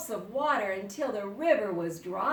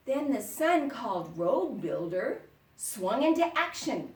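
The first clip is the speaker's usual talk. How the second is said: ordinary delivery, nothing marked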